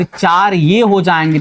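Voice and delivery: ordinary talk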